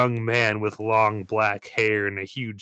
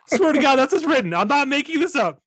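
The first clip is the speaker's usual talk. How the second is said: much higher and louder, insisting; laughing protest; 6 dB louder than usual. Real, not acted. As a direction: swear it's really written, half laughing. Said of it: "Swear to God, I didn't write this".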